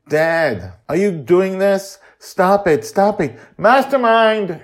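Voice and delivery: put-on high, loud child calling out; girl's frantic cry; panicked child. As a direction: plead then call for help. Joy panics and calls watcher.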